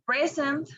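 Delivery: "Present" stressed on PRE; stress on first syllable. Shown as a demonstration. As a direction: say "Present" as PRE-sent, the adjective. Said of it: "the stress in the first".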